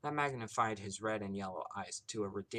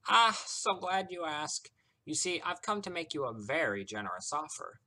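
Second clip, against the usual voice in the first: high, loud, showman's sales pitch; delighted trickster; 8 semitones higher, 7 dB louder than usual. A narrator's reading. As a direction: draw out the delight, then present grandly. Voices Discord making an offer.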